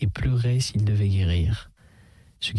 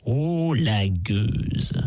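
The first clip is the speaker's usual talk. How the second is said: ordinary delivery, nothing marked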